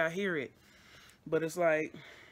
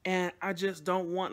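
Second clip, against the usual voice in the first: usual speaking voice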